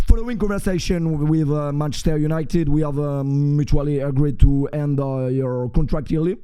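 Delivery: put-on halting, drawn-out English in a French accent. Streamer mock-announcing a contract ending, French-accented English. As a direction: deliver a slow English statement with French vowels. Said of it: "C'est bon, là, je suis en anglais".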